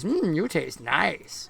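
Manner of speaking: slow, soft monster savoring a bite; savoring creature. Acted. Creature eating Huxley's flesh to its victim, slow savoring. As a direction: murmur it slowly, relishing the taste. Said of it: "eats some of Huxley's flesh and says".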